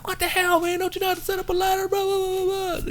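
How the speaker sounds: very high-pitched mock rant; imitated angry yelling. Boss yelling at Dean over the ladder, mock angry. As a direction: rant in a high mocking voice, tailing into gibberish. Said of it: "Terry started yelling at him".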